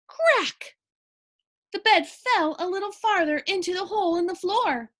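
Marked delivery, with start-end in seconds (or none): 0.1-0.8 cracking sound effect. A narrator's reading.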